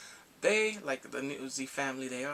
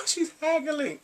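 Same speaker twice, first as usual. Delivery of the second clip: high, loud amused squeal; amused outburst; 13 semitones higher, 7 dB louder, slower than usual. Real, not acted. Vlogger tickled by the haggling, loud high amused outburst. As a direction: burst out with delighted disbelief.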